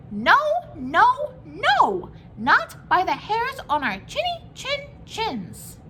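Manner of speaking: high, loud squeaky pigs; little pigs. A narrator's reading. Two little pigs shouting back at the wolf, high and loud. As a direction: cry it out high and defiant. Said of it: "so they answered back".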